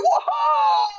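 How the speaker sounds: imitating a wild, high whoop of joy; hammed-up ecstatic yell; mock scream. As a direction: shout it way up high like a man gone crazy with glee.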